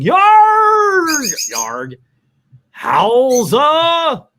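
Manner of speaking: high, loud hammed-up yell; mock yelling. Mannix yelling as he runs out, in a loud mock yell. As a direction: bellow like a cartoon madman. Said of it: "Mannix yelling".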